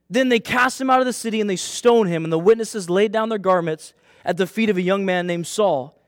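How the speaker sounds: usual speaking voice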